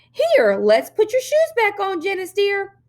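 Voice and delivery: put-on high, slow, showy little girl voice; little girl playing caring sister for the police; too-loud sweet girl. A narrator's reading. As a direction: perform it for the departing police. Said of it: "a little too loudly".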